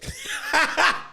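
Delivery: shrieking laugh, very high; high burst of laughter. Real, not acted. Friend's joke cracks him up.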